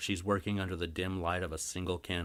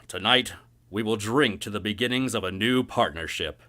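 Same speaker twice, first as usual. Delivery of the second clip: loud, hearty salesman's pitch; booming merchant boss; 4 semitones higher, 9 dB louder than usual. Acted. Grimbly pitching the harbor to his investor, loud and hearty. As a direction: sell it big and hearty.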